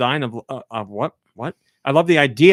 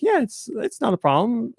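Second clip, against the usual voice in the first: breezy; 11 semitones higher than usual. Retold or imitated. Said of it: "she's like".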